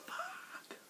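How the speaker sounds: drawn-out voiceless mock scream; soft, airy imitation of a screamed vocal; imitated metal scream. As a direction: scream it in a breathy whisper. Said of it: "Like that kind".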